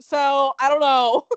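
slow, loud, through laughter; laughing. Real, not acted. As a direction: laugh out the words.

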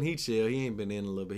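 ordinary talk, nothing marked

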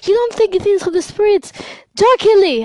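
high, loud incredulous accusation; hammed-up friend shrieking her disbelief; mock accusing friend. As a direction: squeal her outraged question. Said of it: "She's like".

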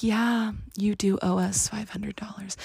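eerie whispered creditor voice; spirit of CBU. Retold or imitated. Debt agency as a haunting spirit, whispering the bill. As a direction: breathe the demand like a ghostly collector. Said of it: "this is the spirit of C B U talking".

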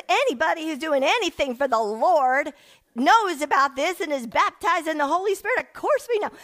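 high hammed-up sassy church lady; church woman's disbelief. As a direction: tease her for even asking. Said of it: "She goes".

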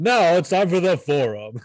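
loud, high radio host. Acted. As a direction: announce it like a radio DJ. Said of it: "super radio voice just now".

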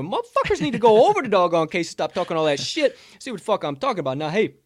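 loud, high pitched pizza hype; hype promo voice. Acted. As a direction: sell the pizza like a wild ad. Hyped promo for Casey's pizza.

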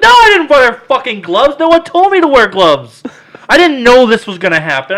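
high, loud, indignant protest; defensive yelling. Retold or imitated. Answering paramedics about gloves, defensive yelling. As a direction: yell excuses back in retold outrage.

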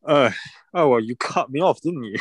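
ordinary talk, nothing marked

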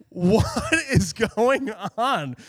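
slow, laughing disbelief. Real, not acted. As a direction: laugh through the question. Laughing while retelling.

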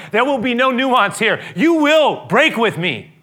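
loud, high and slow; slow emphatic verdict. Acted. Jesus telling Peter he will break, slow and loud. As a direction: lay each word down.

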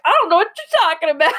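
high, loud, fast squeal of denial; high-pitched playful denial, laughing; mock innocent denial. Acted denial of an accusation, amused.

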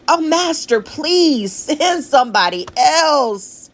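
high whiny pleading voice; pleading Moses. Acted. Moses begging God.

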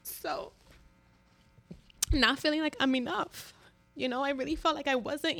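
holding back tears; tearful, voice catching; choked up. Real, not acted. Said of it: "I'm emotional".

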